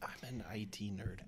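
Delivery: whispered aside; whispering. Acted. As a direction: confess it in a whisper. Mock confession to co-hosts.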